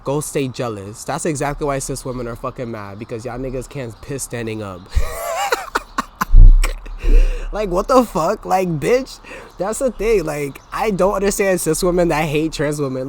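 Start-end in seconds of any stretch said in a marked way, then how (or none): 4.9-7.5 high unvoiced shriek of laughter; laughing at her own joke, high shriek. Real, not acted. Big laugh after joke.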